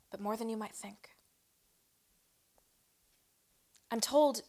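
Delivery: measured spoken-word delivery with weighted pauses; poetry reading, pausing between images; poem recitation. Acted.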